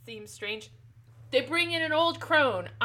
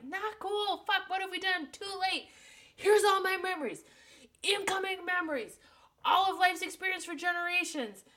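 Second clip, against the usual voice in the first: higher panicked crone voice rising to a yell; 7 semitones higher than usual. Retold or imitated. The crone panicking as her memories pour into Jessica. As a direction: play the old crone freaking out, voice up high. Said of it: "And the crone's like".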